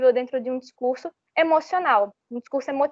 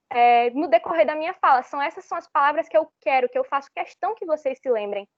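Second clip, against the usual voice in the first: ordinary talk, nothing marked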